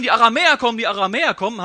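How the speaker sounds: loud alarmed cry of townsfolk; villagers yelling. Retold or imitated. Townsfolk shouting that the Arameans are coming, loud alarm. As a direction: shout the warning across the street. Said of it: "und brüllen".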